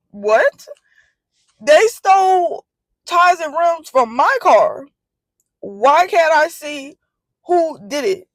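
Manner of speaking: high, slow, outraged questioning; indignant. Retold or imitated. To the leasing office, slow high indignation. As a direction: demand it like it's absurd.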